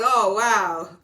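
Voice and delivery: loud, amazed exclamation; big hammed-up astonishment; stunned wow. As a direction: belt out a replayed reaction of shock. Acting out own stunned reaction.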